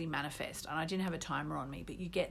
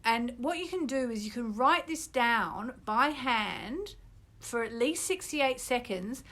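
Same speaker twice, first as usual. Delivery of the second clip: normal talking voice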